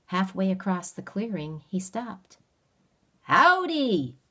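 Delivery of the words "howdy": high, loud backwoods howdy from a stranger; cheerful call. Acted. Stranger greets the boy from mid-clearing, cheerful loud call. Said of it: "he called cheerfully".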